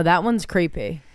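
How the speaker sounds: normal talking voice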